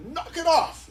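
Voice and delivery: sharp, high, loud stern-dad shout; dad mode shout. Retold or imitated. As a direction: shut the squabbling adults down like a father. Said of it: "you go into dad mode".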